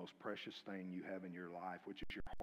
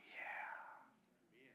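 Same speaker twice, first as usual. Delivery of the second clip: soft breathy awed yeah; whispered yeah; 10 dB quieter than usual. Retold or imitated. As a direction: breathe it in awe. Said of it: "will go".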